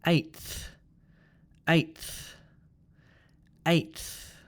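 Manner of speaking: "eighth" with t released into th; tth ending. Shown as a demonstration. Teacher models the only such word, "eighth" with t plus th. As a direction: stop on t, then breathe th in "eighth". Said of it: "let's do the tth sound".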